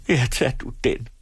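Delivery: tearful. A narrator's reading. Wife surrendering the silver spoon, tearful. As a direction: give in as if crying, mildly. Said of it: "sagde hun grædende".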